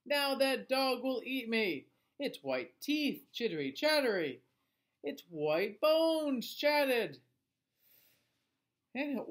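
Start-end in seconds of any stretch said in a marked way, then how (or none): 0.1-7.2 high, slow trembling fear; fearful skeleton. Acted. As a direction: dread the dog's teeth. Trapped skeleton fears being eaten.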